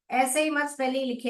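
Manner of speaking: ordinary delivery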